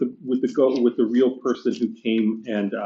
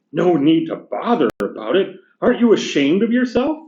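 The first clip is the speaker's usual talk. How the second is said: higher, indignant working-class ghost; gruff big ghost; 4 semitones higher, 4 dB louder than usual. Acted. Teacher reading the ghost complaining to the bright spirit, indignant. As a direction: bluster like an aggrieved ghost.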